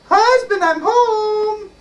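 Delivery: high, ringing falsetto call; giant's wife calling. Acted. Wife coming home to husband, high calling out. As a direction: call through the house way up high.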